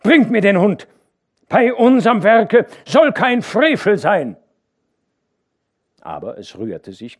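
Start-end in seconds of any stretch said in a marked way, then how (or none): 0.0-4.4 loud, high stern order; angry command. A narrator's reading. Hauke angrily ordering the workers to bring the dog. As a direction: demand it with righteous anger.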